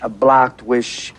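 slowly parroting the therapist's phrase; careful repetition; slow repeat. Real, not acted. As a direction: drag out the unfamiliar words. Repeating prompt awkwardly.

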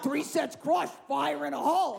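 high, mock drill-style yell; hammed-up battle cry; imitated military scream. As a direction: holler it like an overzealous husband working out. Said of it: "He screams military phrases".

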